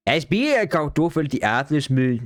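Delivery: exaggerated mushy Danish caricature; mock Danish accent. Mocking Danish to his listeners, mushy Danish caricature. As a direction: slur through it like a mocking Danish impression.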